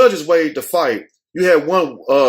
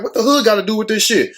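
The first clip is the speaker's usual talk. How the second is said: indignant; 6 semitones higher than usual. Retold or imitated. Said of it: "I'm like".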